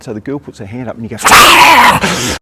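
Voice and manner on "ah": loud high startled yelp; loud hammed-up yelp; mock yelp. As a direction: yelp like a wolf-boy caught out. Mike yelps when touched.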